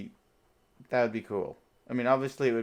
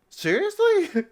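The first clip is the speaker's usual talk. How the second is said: much higher shocked "seriously?"; high incredulous squeak; shocked disbelief; 4 dB louder, much slower than usual. Acted. Cap learns queen changed.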